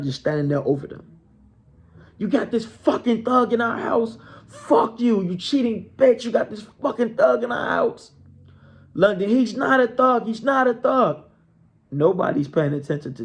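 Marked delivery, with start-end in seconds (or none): 2.2-8.1 higher, heated cursing at the wife; husband berating his wife in their bedroom, mock-furious; angry yelling husband. 8.9-11.2 higher, insistent denial; wife insisting to her husband, high and pleading; high protest. Retold or imitated.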